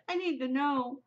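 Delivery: high, voiced burst of impatience; worked-up, high-pitched demand; desperate insistence. Real, not acted. Desperate to learn the ending.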